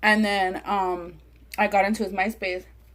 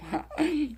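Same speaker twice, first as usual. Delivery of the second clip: higher, sneaky little evil giggle; mock villain snicker at her own trick; evil spy laugh; 6 semitones higher, 7 dB quieter than usual. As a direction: chuckle like a scheming spy. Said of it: "like a little evil spy".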